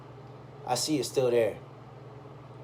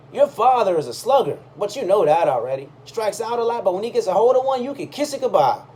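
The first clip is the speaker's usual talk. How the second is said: higher, louder admiring voice; old fan gushing; 7 semitones higher, 6 dB louder than usual. Retold or imitated. Old man praising Willie to his supposed son, higher and louder. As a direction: gush about the slugger like a proud old fan.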